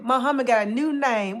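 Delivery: ordinary talk, nothing marked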